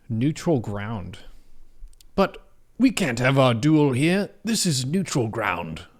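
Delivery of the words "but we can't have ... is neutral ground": mock duel challenge. Mock dramatic standoff line.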